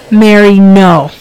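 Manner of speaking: loud, slow "no" shout; shouted objection. Acted. Calling to Mary to stop, loud drawn-out shout.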